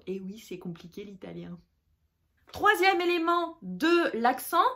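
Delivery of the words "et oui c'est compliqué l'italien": much softer, lower confiding remark; low, quiet side comment; hushed aside. Real, not acted. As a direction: drop your voice right down for an aside. Murmured aside to the learner.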